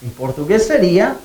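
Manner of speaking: ordinary talk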